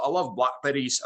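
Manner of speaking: lightly put-on Scottish accent for a joke; a hint of Scottish accent, deadpan. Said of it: "I pretended to be from Scotland".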